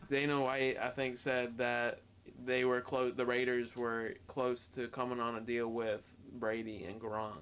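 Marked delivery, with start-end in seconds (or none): none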